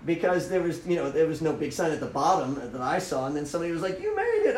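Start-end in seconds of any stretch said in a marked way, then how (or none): 4.0-4.6 high, excited call; cheering spectator. Retold or imitated. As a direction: call out to a runner who made it.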